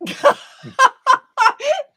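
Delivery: high shrieking laugh. Real, not acted. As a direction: keep cracking up loudly. Still laughing at joke.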